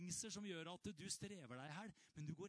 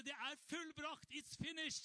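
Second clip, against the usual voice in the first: cried out; 10 semitones higher than usual. Retold or imitated. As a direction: proclaim it like the cry from the cross. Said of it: "Han ropte".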